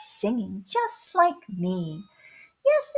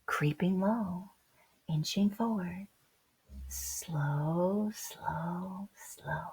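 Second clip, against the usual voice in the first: slow sneaking whisper, drawing out each slow; hushed creeping whisper; 4 semitones lower, 8 dB quieter, slower than usual. A narrator's reading. Narrator whispering to children about a hidden cheetah, hushed. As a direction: whisper it low as if stalking prey.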